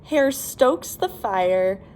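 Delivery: ordinary delivery, nothing marked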